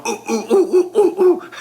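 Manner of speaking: quick, high mock "ooh"s of pain; rising, hammed-up yelps of pain; imitated pain whimpers. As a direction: hoot as if your rear stings. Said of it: "like, in pain".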